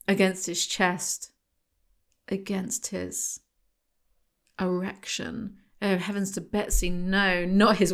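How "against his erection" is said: much softer, slow, pause before the last word; hushed reveal. Acted. Host teasing the listeners with a hushed comic reveal. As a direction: drop almost to a whisper and hold back the word.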